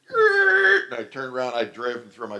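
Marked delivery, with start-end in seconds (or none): 0.1-0.9 high cry of shock. Retold or imitated. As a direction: yelp as the glass breaks. Car crash shock.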